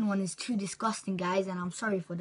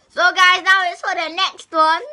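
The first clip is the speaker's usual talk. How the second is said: excited announcing; 8 semitones higher, 13 dB louder than usual. Real, not acted. Announcing next insect to camera.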